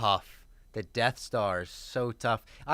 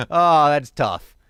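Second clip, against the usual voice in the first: laughing; 3 semitones higher, 13 dB louder, much slower than usual. Real, not acted. Laughing after Death Star reveal.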